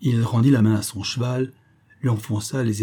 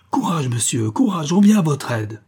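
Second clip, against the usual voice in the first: rallying call, building louder and higher; 5 semitones higher, 4 dB louder than usual. A narrator's reading. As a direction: urge the drowning man on. Swimmer calls to a drowning man.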